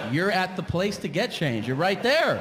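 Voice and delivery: high, exasperated scolding; annoyed crowd telling someone off; indignant outburst. Retold or imitated. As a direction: berate him for missing the obvious. Line of customers berates him.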